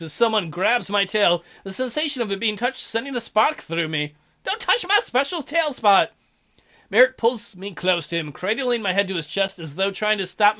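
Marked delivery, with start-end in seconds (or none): 4.4-6.1 higher squeaky protest; mock startled protest. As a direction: yelp it playfully. Tail grabbed.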